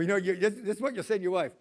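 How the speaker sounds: ordinary talk, nothing marked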